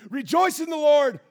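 loud, high call to rejoice; proclaimed shout. Acted. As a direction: preach it out over the crowd. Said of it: "He says".

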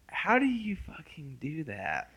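soft unvoiced whisper. Real, not acted. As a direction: whisper it under your breath. Whispered aside.

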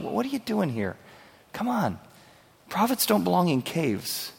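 high and soft, tenderly coaxing; a gentle nudge, not a scolding; tender question. Acted. God coaxing the prophet out of the cave, tender and soft. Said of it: "You hear his tenderness in the form of a question".

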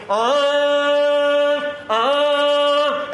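long, high held "ah" blast; imitated heralding fanfare. Someone announcing the king to chattering people, imitated fanfare. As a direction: sound out two sustained herald notes.

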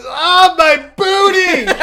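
very high, loud wail, slowing at the end; imitated child's scream. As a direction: shriek like a hurt kid, laughing as you tell it. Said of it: "screaming, and goes".

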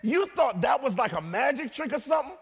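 lightly voiced Jesus, high and bold; voicing Jesus. Pastor voicing Jesus boasting of power, a hint of a shout. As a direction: play Jesus teasing the disciples, loud. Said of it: "Jesus is like".

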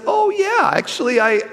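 a guilty high yelp of admission; caught-out squeak. Retold or imitated. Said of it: "I said".

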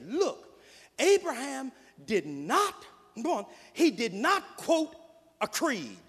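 loud, rising preacher's cadence; full-force preaching. Real, not acted. Preacher pressing the point on the congregation, full force. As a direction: drive it home from the pulpit.

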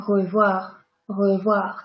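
Revoir with a light uvular R at start and end; gentle R on both ends of Revoir; soft French R. Shown as a demonstration. Teacher isolating the word to show its R sounds. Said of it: "There are two R".

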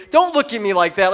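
ordinary talk

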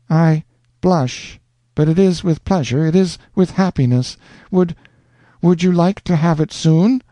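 high, shy blushing voice; small shy voice. A narrator's reading. Rosanna answering the proposal, shy and pleased. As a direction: answer bashfully. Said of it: "a different small voice replied".

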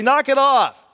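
loud, high-pitched scolding; exasperated parental shout; sharp telling-off. Acted. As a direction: bark it at rowdy kids. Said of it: "You say".

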